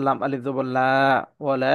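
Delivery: ওয়ালা with its last vowel held long; three-alif madd. Shown as a demonstration. Teacher shows a three-alif stretch, long held vowel. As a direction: draw out the final aa of ওয়ালা steadily. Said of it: "এখানে কিন্তু তিন আলিফ টান আছে".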